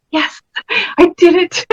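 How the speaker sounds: high rising cry of victory; triumphant. Retold or imitated. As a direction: celebrate like you just won. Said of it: "it's like".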